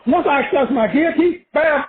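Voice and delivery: ordinary delivery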